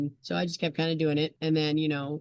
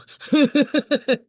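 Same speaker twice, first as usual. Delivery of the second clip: a sudden loud burst of real laughter; 10 semitones higher, 11 dB louder than usual. Real, not acted. Guest laughing at herself about getting winded, loud real laugh. Laughs at her own reaction.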